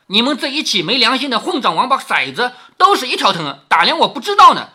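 acted scolding, building in volume; cursing. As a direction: berate servants and bark an order. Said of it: "骂道".

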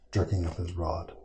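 plain reading voice

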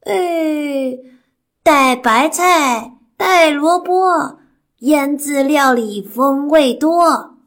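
slow, loud, high granny voice; old granny. Acted. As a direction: chant it like a cheerful old granny. Granny answers in rhyme.

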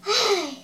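long, falling sigh; drawn-out sigh of giving in; acted sigh. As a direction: sigh with resignation. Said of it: "接着他叹了口气".

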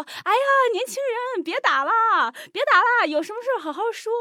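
high-pitched hammed-up fussing of a crowd; imitating bystanders. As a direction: plead like onlookers breaking up a fight. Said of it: "有一堆人都在说".

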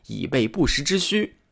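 plain reading voice

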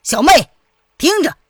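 loud, high call across the water; loud, resolute projected voice; ringing call. A narrator's reading. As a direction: call out to a captive sister. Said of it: "朗声道".

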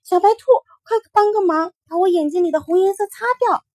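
high, loud, urgent begging; a frightened boy pleading for help; anxious child's plea. Acted. Mingming begging the rabbit for help, high and urgent.

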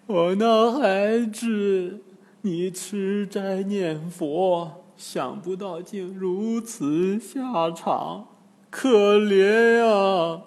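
slow, high, drawn-out lament; wailing. A narrator's reading. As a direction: wail over the dead daughter. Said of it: "突然大哭起来". (拖长声音哭诉)

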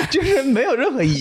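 laughing. Real, not acted. Repeats the point while laughing.

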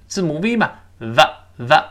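ordinary talk

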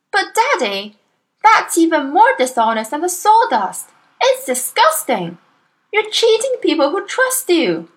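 put-on high child voice, shocked and reproachful; a little girl scolding her cheating father; indignant young Matilda. A narrator's reading.

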